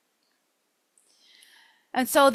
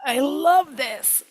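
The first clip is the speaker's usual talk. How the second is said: savouring delight; 3 semitones higher, much slower than usual. Retold or imitated. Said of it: "you're like".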